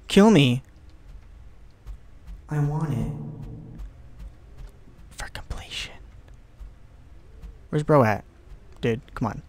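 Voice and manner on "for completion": very soft, whispered aside; hushed whisper. Pretend. Player wanting death for the last ending, hushed whisper. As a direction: whisper it almost to yourself. Mock plea to be killed.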